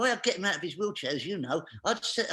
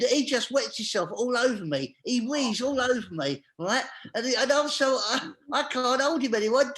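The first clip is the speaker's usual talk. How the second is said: ordinary talk, nothing marked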